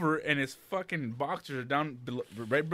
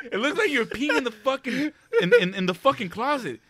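laughing; 10 semitones higher, 4 dB louder than usual. Retold or imitated. Retort while laughing.

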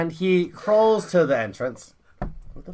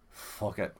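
low, very soft giving up; resigned mutter; 8 semitones lower, 16 dB quieter than usual. Acted. Drunk man looks at the stairs, low resigned mutter. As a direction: drop it to a defeated murmur.

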